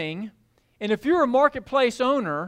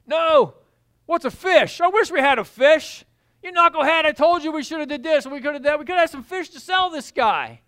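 grumbling; 6 semitones higher, 4 dB louder than usual. Acted. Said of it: "kinda chuckle about the disciples".

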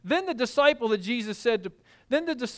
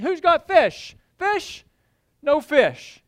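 calling out; 6 semitones higher, 4 dB louder, slower than usual. Acted. Voice calling along the shore.

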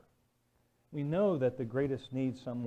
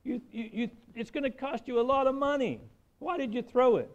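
high, loud outraged shouting; a driver yelling at the boy over his new Jaguar; angry rant; 12 semitones higher, 7 dB louder than usual. Retold or imitated. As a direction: let the fury spill out over the dented car. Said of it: "Angry, right?".

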